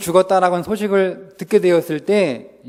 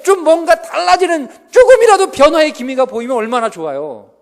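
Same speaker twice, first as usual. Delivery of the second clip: much higher and louder, building; impassioned plea; 7 semitones higher, 6 dB louder than usual. Acted. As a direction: cry it out like a desperate believer. 간절한 설교의 절정. (간절하게 외치는 설교)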